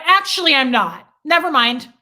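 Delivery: re-enacted indignant outburst; pissed-off retort. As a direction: retract the apology with a sudden loud burst of temper. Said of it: "I was pissed. I had no self-control".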